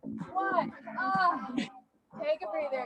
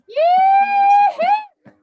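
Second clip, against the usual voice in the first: loud high whoop; whooping yee-hoo; 15 dB louder than usual. Real, not acted. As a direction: cheer it out at full voice. Cheering on class mid-workout.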